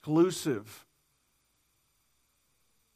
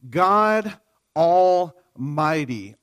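God Almighty, drawn out very slowly, each word weighted; savoring the name; 3 dB louder, much slower than usual. Shown as a demonstration. Pastor making the congregation hear the name, slow and weighty. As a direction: let God Almighty hang in the air, very slow and deliberate. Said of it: "stop there for a second and listen to that".

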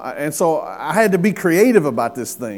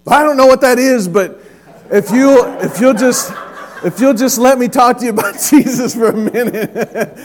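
high, loud, stumbling plea with laughter creeping in; frantic mock plea; 8 semitones higher, 8 dB louder than usual. Nervous teen begging an atheist to listen, loud comic pleading. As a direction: plead loudly and eagerly, tripping over the start.

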